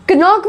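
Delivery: क्नॉक with k voiced before n; wrong sounded k. Shown as a demonstration. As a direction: misread क्नॉक as k-nock. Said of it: "बोलोगे क्या".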